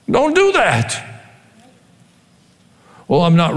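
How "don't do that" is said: "Don't do that." repeated much higher and slow, mock-stern. Shown as a demonstration. Preacher repeats the warning to the congregation, high and slow. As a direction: stretch out "Don't do that" high like scolding a child. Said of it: "So just don't do that".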